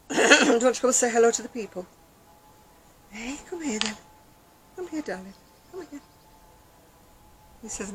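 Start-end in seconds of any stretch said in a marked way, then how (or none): 0.1-1.9 loud, higher call across the room to the pet. Real, not acted. Calling the pet over loudly. 3.1-6.0 higher, sweet coaxing call to the pet. Real, not acted.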